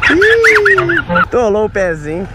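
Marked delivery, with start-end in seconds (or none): 0.1-1.3 high sudden yelp of surprise; a quick startled cry; surprised yelp. Real, not acted. Foot sinks into the mud.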